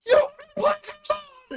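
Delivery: high, drawn-out road-rage scream; pissed-off yell. Retold or imitated. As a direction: scream it furiously at the car ahead. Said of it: "that pissed him off so bad".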